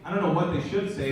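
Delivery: ordinary talk, nothing marked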